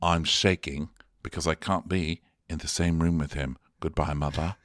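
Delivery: low-pitched hammed-up exit speech; mock wounded solemnity. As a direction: announce your retreat in a deep voice. Acted solemn exit speech to mother.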